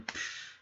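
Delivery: hissed "psh" of spraying liquid; imitated spray noise. Game master narrating the acid spray, imitated noise. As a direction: make a splashy burst sound with the mouth.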